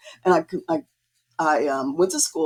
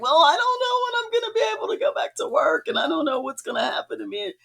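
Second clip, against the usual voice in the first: mock upset; 11 semitones higher, faster than usual. Artist retelling her answer to her primary care doctor, mock upset. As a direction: imitate yourself panicking and near tears. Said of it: "all upset".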